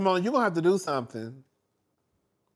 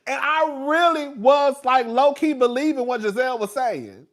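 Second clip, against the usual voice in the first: loud, higher, emphatic admission; worked-up, raised-voice disbelief at oneself; indignant confession; 7 semitones higher, 8 dB louder than usual. Real, not acted. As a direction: confess it at full volume.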